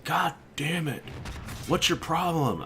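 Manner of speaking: high, put-upon grumble of a guy dragged awake; irritated high-pitched hungover complaint; annoyed groggy buddy. Retold or imitated. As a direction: whine it like a hungover friend being woken.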